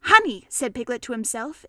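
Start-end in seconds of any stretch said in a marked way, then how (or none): none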